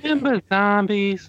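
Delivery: very high, put-on little creature voice; mock-nostalgic high cartoon voice; squeaky cartoon voice. Cartoon nostalgia voice riffing on remember.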